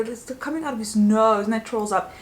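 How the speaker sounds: ordinary talk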